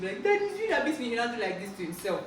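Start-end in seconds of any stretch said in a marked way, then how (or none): 0.2-1.1 put-on crying child. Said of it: "maybe we're crying".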